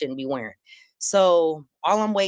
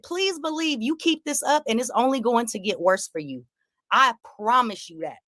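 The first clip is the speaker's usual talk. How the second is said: mock warning; 6 semitones higher than usual. Warning Corey in the street.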